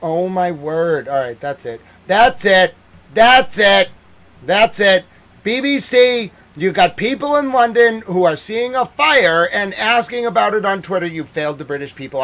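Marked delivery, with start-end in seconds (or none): 2.1-5.0 loud, high angry outburst; fed-up shouting. Real, not acted. Ranting at the BBC, fed up, shouting. 5.4-11.1 loud, high scolding shout; angry shout. Real, not acted. Berating the BBC for missing the news, shouting.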